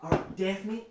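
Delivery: high, loud flustered mother; harried mom voice. Acted. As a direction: rush them like a busy mom.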